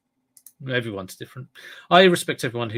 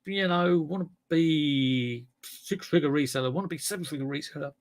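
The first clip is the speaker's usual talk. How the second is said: ambitious reseller; 4 semitones higher than usual. Retold or imitated. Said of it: "some people ... be like".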